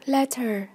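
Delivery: "Letter" stressed on LET; weight on LET in "Letter", falling after; stress on first syllable. Shown as a demonstration. Teacher modelling vocabulary for learners, "Letter" stressed on LET. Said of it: "nhấn âm một".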